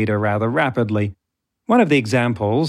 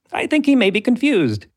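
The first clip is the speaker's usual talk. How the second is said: high squeaky chipmunk robot; 11 semitones higher than usual. Retold or imitated. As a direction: pitch it way up like a chipmunk. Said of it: "[name]'s voice is like Alvin and the Chipmunks".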